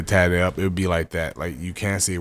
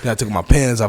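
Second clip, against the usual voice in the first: ordinary talk, nothing marked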